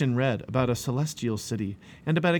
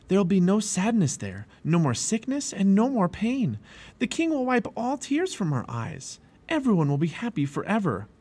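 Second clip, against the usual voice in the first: high, eager, emphatic promise; joyful promise; 8 semitones higher than usual. A narrator's reading. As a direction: list the wonders with rising enthusiasm. Describing heaven eagerly.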